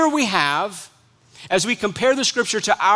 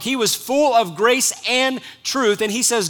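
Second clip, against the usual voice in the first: ordinary talk, nothing marked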